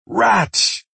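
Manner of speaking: sharp, high-pitched cry of warning; alarmed call. A narrator's reading. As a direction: call out the danger suddenly. Alarm call at approaching rats.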